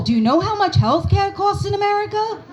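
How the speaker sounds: usual speaking voice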